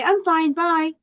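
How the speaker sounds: high, offhand reply; dismissive brush-off. Shown as a demonstration. Teacher mimics someone who won't stop to talk, offhand. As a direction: toss it off and leave. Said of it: "not going to just say".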